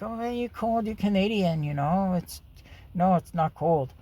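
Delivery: high-pitched mimicry of a scoffing date; imitating teasing date. Mimicking a date who doubts he is cold, high and teasing. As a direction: put on her voice, pitched well up.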